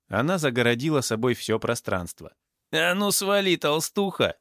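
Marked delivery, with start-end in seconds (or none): 2.7-4.4 high-pitched yell of abuse; shouted insult. A narrator's reading. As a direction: yell at her to get out of the way. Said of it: "выкрикнул амбал". (грубый выкрик на высоких тонах)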